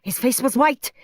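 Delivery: ordinary delivery, nothing marked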